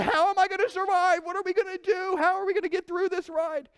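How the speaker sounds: high, strained panicked voice; panicking. Retold or imitated. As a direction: squeal it out in hammed-up panic. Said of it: "And they're panicking".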